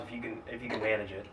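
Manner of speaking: ordinary talk, nothing marked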